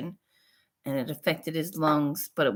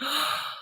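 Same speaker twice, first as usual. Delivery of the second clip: sharp quiet intake of breath; a sudden gasp of delight; surprised gasp; 11 semitones higher, 4 dB quieter than usual. Retold or imitated. Reading her email, a sharp delighted gasp.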